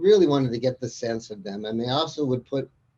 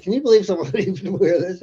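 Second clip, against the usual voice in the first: laughing quote; 6 semitones higher, 4 dB louder than usual. Retold or imitated. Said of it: "it would be like".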